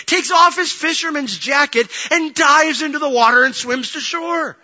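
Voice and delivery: high, loud, driving sermon voice; full-force preaching. Acted. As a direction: shout the story to the back row.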